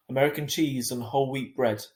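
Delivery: ordinary delivery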